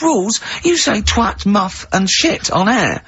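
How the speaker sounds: high-pitched mock indignation; indignant retort. Co-host throwing the rules back at him, indignant. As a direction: imitate an incredulous, drawn-out objection.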